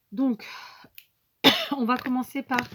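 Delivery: runny nose, sniffling between words. Real, not acted. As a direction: talk through a stuffy, sniffly nose. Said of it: "pardon, je n'arrête pas de renifler". (voix qui renifle, nez qui coule)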